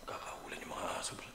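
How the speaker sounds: hushed unvoiced whisper. Real, not acted. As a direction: whisper it, tense and low. Dogs barking during the hunt.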